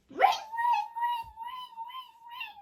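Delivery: very high, loud ring ring; high ringing phone imitation. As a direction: chirp it like a phone bell.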